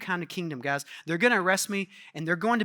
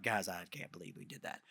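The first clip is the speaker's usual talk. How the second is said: much softer, low embarrassed mumble; embarrassed mutter; 13 semitones lower, 17 dB quieter than usual. Retold or imitated. Hushed embarrassed aside. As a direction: disciples later, embarrassed at themselves, muttering. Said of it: "I'm sure they're like".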